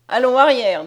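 brusque sentry's shout; barked order. A narrator's reading. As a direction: order someone back forcefully. Soldier orders the girl back.

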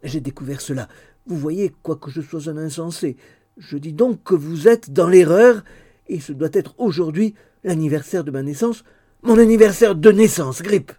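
eager, loud and high; excitable young man. Acted. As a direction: blurt it out like a simple lad. Barnaby speaking in the story.

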